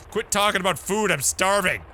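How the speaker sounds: loud, high exasperated plea; hammed-up frustrated outburst; hungry protest. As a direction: cut them off, desperate from hunger. Hungry character protesting food talk.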